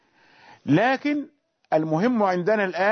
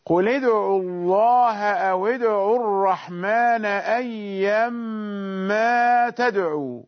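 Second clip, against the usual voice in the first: Quran recitation. Shown as a demonstration. Teacher reciting the verse before explaining the pause. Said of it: "قوله تعالى".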